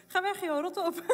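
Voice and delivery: imitating her furious outburst; angry retort. Wife snapping at husband to go away, mock angry. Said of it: "woest was ik op hem".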